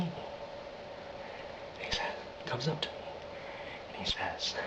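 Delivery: hushed, halting deranged Joker; Joker impression. Telling of the knife attack, creepy hushed Joker. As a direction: sink into a creepy whispered confession. Performing Joker's scars monologue.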